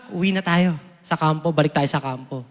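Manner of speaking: low, soft leader's reply; calm Joshua. Retold or imitated. Joshua quietly telling troops to return to camp, low.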